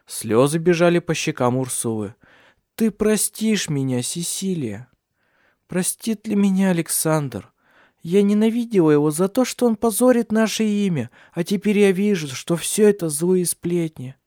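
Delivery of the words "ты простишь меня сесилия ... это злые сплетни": tearful plea. A narrator's reading. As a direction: beg forgiveness through tears. Ursula weeping, asking forgiveness.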